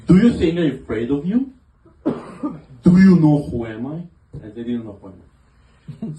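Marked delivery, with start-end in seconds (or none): 0.1-4.0 mock tough guy. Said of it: "I decided to be really tough".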